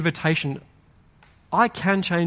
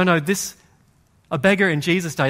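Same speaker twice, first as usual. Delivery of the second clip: ordinary delivery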